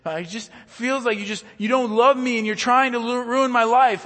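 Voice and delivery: put-on high, loud wailing child's complaint; mock whiny child. Pastor imitating a child mid-meltdown, high loud whine. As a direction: wail it like a kid in a meltdown. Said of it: "their kid is".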